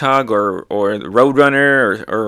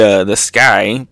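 usual speaking voice

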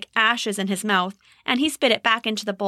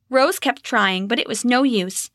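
ordinary delivery, nothing marked

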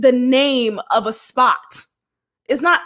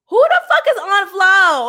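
laughing disbelief; 7 semitones higher, 5 dB louder than usual. Real, not acted.

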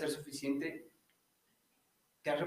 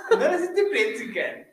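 surprised; 10 semitones higher, 9 dB louder, slower than usual. Real, not acted. Teasing disbelief.

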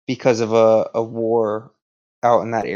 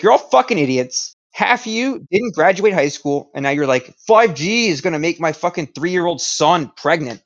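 mock cursing rant; 5 semitones higher than usual. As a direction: play a raised, higher-pitched presidential tirade. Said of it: "I'd be cursing at everyone the entire time".

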